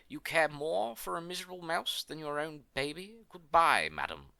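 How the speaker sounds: loud, indignant scolding; enraged. A narrator's reading. Old woman scolding the queen over a mouse, enraged. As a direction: fling it at her, punching the goodbye. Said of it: "cried the woman in rage".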